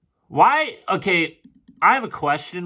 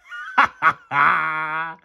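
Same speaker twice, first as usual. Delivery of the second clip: amused laugh. Real, not acted. Laughing at the play.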